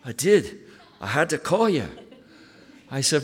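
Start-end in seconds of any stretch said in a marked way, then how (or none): 0.0-1.9 retold pastor in a lightly done broad Glaswegian accent, eager; phone call from a Scottish friend, half-acted with a chuckle; imitating a Glasgow pastor calling with news, mild Glaswegian lilt; Barry's Glaswegian accent. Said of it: "And he says".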